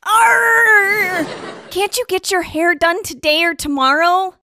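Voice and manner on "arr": loud exasperated arr; frustrated growl. Acted. Frustrated cry at news.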